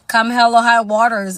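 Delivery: normal talking voice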